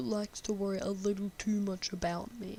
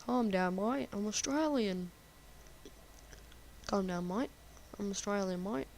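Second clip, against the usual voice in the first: put-on Australian accent, mate lifted at the end; fake Australian accent; 5 dB quieter than usual. Joking that they're Australian, fake Australian accent. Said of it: "I have to use my fake Australian accent".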